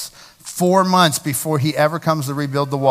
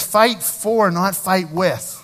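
"Fight for" punched higher, "not fight with" slower; stress on for and with; 3 semitones higher, much slower than usual. Shown as a demonstration. Preacher contrasting fighting for with fighting with. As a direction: lean hard on "for", then on "with". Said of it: "Find it interesting here".

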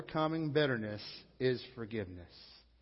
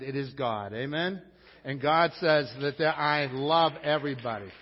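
louder exhortation, rising in energy; preacher sending the congregation out to love everybody; full-force preaching; 7 dB louder than usual. Acted. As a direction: urge them out the door to love.

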